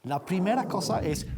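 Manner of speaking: normal talking voice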